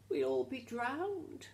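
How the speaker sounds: high, slow, loud little squeak; squeaking mouse. A narrator's reading. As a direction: squeak it like a tiny frightened mouse. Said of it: "squeaked the little mouse".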